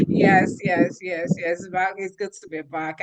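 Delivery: ordinary talk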